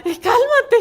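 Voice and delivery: high, loud teasing through laughter; laughing mock scold. Son teasing his mother to stop embarrassing herself. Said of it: "el otro así de".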